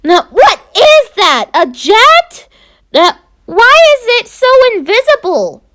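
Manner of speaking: high, bewildered questions; baffled pup squeaking his questions; puzzled surprise. Acted. Confused pup after bumping jet.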